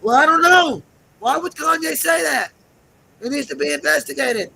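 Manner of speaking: high, loud mock indignation; hammed-up outraged yell; mock outraged shout. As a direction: yell it back like you're fed up. Mock outrage answering critics.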